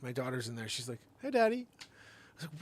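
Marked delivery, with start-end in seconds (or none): 1.2-1.7 put-on higher, cheerful child voice; little daughter. Daughter greeting her dad from the dog's mouth, childlike. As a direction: chirp it like a carefree kid.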